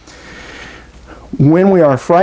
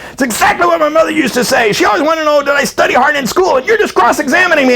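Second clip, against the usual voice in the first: hammed-up shrill accusation, pitched way up; mock outburst; 12 semitones higher than usual. Man snapping at his partner who asked about his day, high mock yell. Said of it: "where the hell did that come from".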